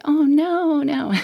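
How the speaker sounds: high-pitched mock-alarmed "oh no"; retold dismay. Reacting to bad cholesterol result.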